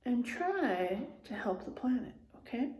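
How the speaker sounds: whispered, gentle request; soft plea. Real, not acted. Teacher softly asking the children to help the planet. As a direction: ask it softly and sincerely.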